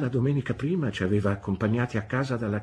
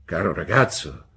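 low, louder exclamation; deep-voiced gentleman; 4 semitones lower, 6 dB louder than usual. A narrator's reading. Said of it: "nella sua profonda voce".

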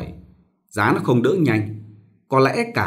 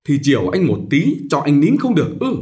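scolding; 4 semitones higher, 4 dB louder than usual. Acted. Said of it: "Bà có mặt mắng nó".

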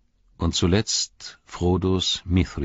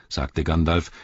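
ordinary delivery

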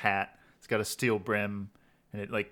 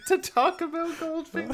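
mock shock. Real, not acted.

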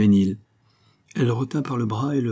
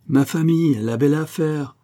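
raised, louder scoff at the idea; a young count's higher, louder brush-off; dismissive outburst; 6 semitones higher, 4 dB louder than usual. Acted. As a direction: wave it away with a hot retort. Xavier brushes off family objection.

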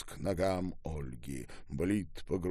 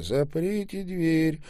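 ordinary delivery, nothing marked

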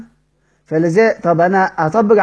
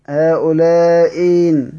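slow demo; much slower than usual. Shown as a demonstration. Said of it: "بنبدلها حرف مد".

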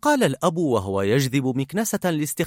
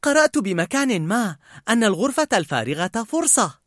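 higher, upbeat pitch of an idea; bright-side pep talk, raised pitch; enthusiastic; 7 semitones higher than usual. A narrator's reading. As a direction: say it with eager optimism. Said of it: "فقالت بحماس".

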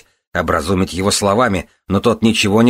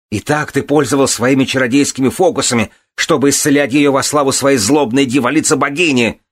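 high, raised furious accusation; enraged yell; 7 semitones higher, 4 dB louder than usual. A narrator's reading. Son accusing the priestess of sorcery, yelling. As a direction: hurl the accusation at her. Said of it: "заорал он".

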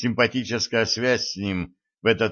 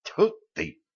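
disgusted spit; 11 semitones higher, 3 dB quieter than usual. A narrator's reading. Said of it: "смачно сплюнул вампир".

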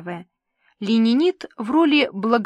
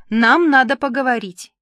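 louder, higher, urgent insistence; insistent demand; 4 semitones higher, 7 dB louder than usual. A narrator's reading. Ниночка catches her in the corridor, insistent. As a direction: press for a talk at once.

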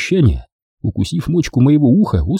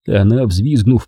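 ordinary delivery

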